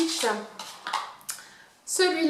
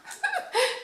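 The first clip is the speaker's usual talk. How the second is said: laugh; 5 dB quieter than usual. Real, not acted. Laughs at own bad English.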